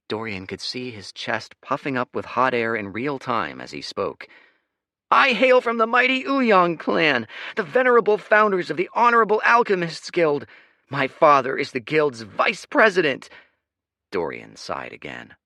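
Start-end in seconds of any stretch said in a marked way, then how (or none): 5.1-13.3 pompous boast. Acted. Leo boasting lineage.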